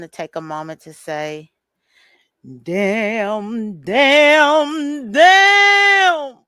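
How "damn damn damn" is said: each damn higher and louder, building to a wail; Good Times mother's lament, rising with each damn; Florida Evans. Retold or imitated. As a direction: climb from plain to a loud high cry over three damns. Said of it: "in my Florida Evans voice".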